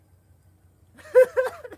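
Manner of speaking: high loud real laugh; loud laugh burst. Real, not acted. Vlogger breaking up over the preacher's smile, loud laugh. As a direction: crack up loudly.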